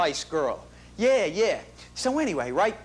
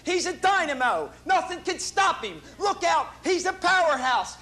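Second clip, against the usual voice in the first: excited; 8 semitones higher than usual. Acted. Proud dad hyping his son.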